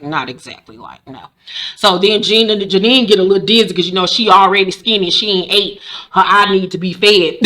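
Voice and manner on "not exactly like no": much softer, low mumbled no; very soft denial. Retold or imitated. June answering Steven, fading soft denial. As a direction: let it fade to nearly nothing. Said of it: "and June was like".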